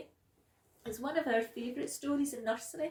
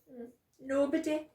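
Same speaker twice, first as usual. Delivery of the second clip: grumpy reply; 3 semitones higher, 8 dB louder than usual. A narrator's reading. Sleepy stubborn child.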